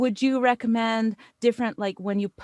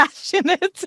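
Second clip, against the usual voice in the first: laughing; 7 semitones higher, 7 dB louder than usual. Real, not acted. Laughing at her own wordplay.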